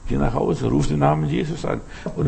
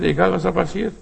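ordinary talk, nothing marked

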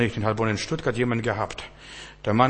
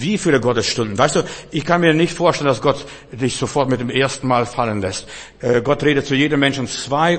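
forceful preaching; 2 semitones higher, 5 dB louder than usual. Acted.

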